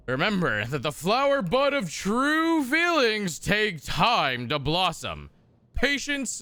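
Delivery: a hint of a stately narrator; mock courtly reading. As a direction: read it like a dusty noble manual. Reads game codex text aloud.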